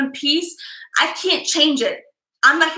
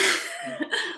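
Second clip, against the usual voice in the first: long relieved whew; big exhale; 10 dB quieter than usual. Real, not acted. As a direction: blow out a big breath.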